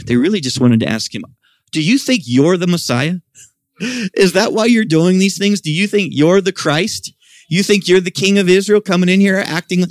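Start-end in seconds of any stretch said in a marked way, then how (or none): 1.7-9.8 high, challenging voice of scornful priests; incredulous priests. Retold or imitated. Priests challenging Jesus in the temple, high incredulous demand. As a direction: demand it like a suspicious priest sneering at an upstart.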